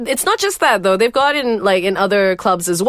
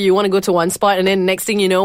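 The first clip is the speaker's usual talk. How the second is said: ordinary delivery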